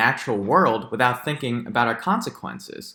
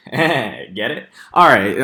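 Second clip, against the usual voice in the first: ordinary talk, nothing marked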